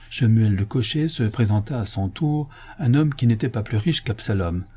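ordinary delivery

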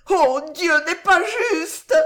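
very high, loud, slow outcry; anguished reproach. A narrator's reading. Girl believes him dead, high anguished reproach. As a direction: wail it in reproach at heaven. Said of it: "sur un ton de reproche indicible".

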